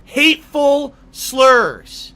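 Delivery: loud, high and very slow; drawn-out mockery. Streamer mocking the accusation against him, loudly drawn out.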